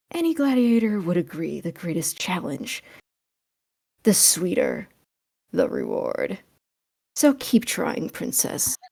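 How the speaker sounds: soft teasing half-whisper. Acted. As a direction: murmur it close to her ear. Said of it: "whispers in Ginny's ear".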